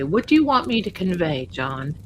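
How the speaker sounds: ordinary talk, nothing marked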